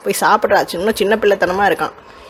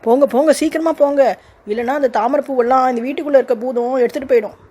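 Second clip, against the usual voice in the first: put-on higher, urgent child voice pushing him on; eager little girl; 5 semitones higher than usual. Girl rushes the uncle toward the pond, excited urging. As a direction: hurry him off like an impatient child.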